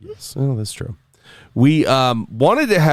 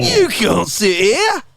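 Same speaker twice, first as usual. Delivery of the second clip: mock movie voice; 12 semitones higher, much slower than usual. Mocking a movie line.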